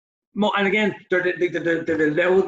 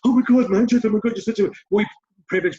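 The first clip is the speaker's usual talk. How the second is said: mock outraged activist; 5 semitones higher, 3 dB louder, faster than usual.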